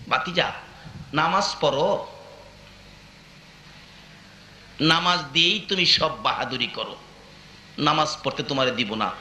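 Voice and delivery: low-pitched menacing voice; threatening uncle. Retold or imitated. Uncle threatening his praying nephew, low and menacing. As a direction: drop the pitch and threaten in short phrases.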